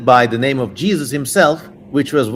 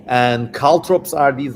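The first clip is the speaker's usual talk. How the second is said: ordinary delivery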